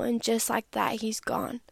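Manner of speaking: ordinary delivery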